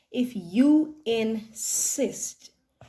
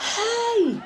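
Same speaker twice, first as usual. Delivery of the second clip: high squealing hi; squealed greeting; 11 semitones higher than usual. Acted. Friend arriving squeals hi in excitement. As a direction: squeal it with delight.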